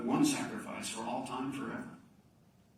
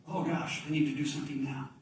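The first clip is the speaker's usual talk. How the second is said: mock fluster; 2 semitones higher than usual. Mock hurried Jesus.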